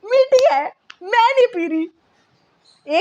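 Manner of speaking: high, whining refusal, slow at the end; a sulky kid refusing the medicine; whiny child. Retold or imitated. Mom imitating her daughter refusing the tonic, whiny and slow. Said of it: "यूँ कहती थी".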